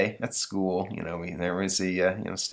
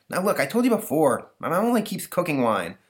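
put-on higher, lisping kid voice; lisping boy Stanley, exasperated; 9 semitones higher than usual. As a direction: talk like an exasperated boy with a lisp.

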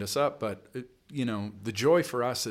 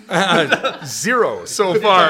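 laughing; 8 semitones higher, 14 dB louder, much slower than usual. Real, not acted. Laughs at the money question.

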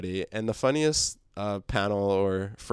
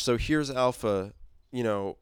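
ordinary talk, nothing marked

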